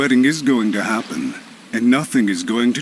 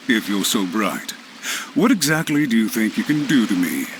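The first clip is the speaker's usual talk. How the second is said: usual speaking voice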